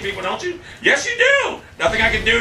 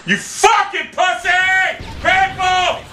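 furious shout; 11 semitones higher, much slower than usual. Real, not acted. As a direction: bellow it out in real rage. Raging, calling on viewers.

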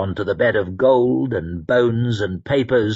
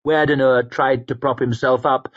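usual speaking voice

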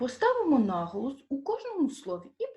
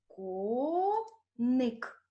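Коник as two slow, separated syllables; split into syllables; 3 semitones higher than usual. Shown as a demonstration. Teacher dividing Коник for pupils, split into syllables. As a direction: hold Ко, pause, then ник. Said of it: "Ділимо друге слово".

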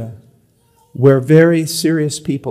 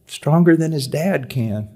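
ordinary talk, nothing marked

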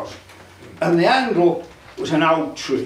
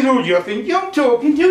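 indignant devil; 6 semitones higher, 5 dB louder than usual. Acted.

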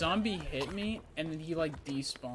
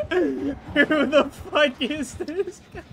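dawning joy; 4 semitones higher, 9 dB louder, slower than usual. Real, not acted. Friends gaming, unbelievable enemy blunder.

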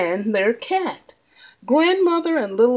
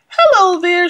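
put-on little girl voice; 8 semitones higher, 7 dB louder than usual. Girl greets the fox.

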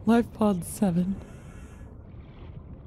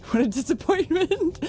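mock disappointment; 10 semitones higher than usual.

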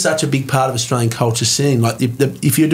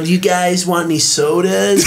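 put-on American accent; 5 semitones higher than usual. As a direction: shout it like a cheesy American waiter. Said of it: "I have a character that I go like".

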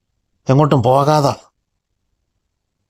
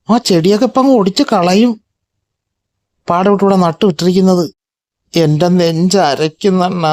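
put-on higher, whining complaint of a hurt girl cat; a wounded little cat complaining, pitched up; upset girl cat; 5 semitones higher than usual. Sad female cat complaining to her friend about the rival, higher whine.

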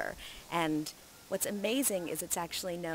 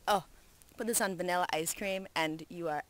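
ordinary talk

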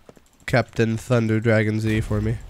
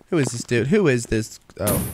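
ordinary delivery

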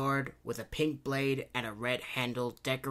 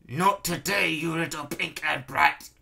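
raised, louder villain's sneer; 5 semitones higher, 5 dB louder than usual. A narrator's reading. Baron dismissing Princess Kitty, sneering. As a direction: sneer at your young challenger. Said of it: "he sneered".

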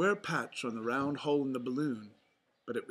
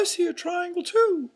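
high, loud falsetto mouse shout; Mickey Mouse shout; 9 dB louder than usual. A narrator's reading. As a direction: call out in a squeaky-high cartoon voice. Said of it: "Mickey shouted".

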